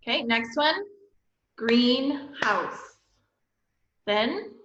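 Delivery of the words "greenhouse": GREENHOUSE slowly split, GREEN, pause, HOUSE; two separate syllables. Shown as a demonstration. Teacher modelling syllables for students, GREENHOUSE broken into two beats. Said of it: "Each one should have two".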